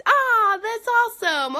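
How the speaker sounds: high, loud burst of enthusiasm; real excited exclamation; delighted. Real, not acted. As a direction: gush at a viewer's news. Delighted reaction to viewer comment.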